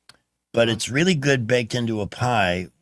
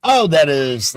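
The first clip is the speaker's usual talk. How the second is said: excited; 5 semitones higher, 8 dB louder, slower than usual. Real, not acted. Reacting to good news.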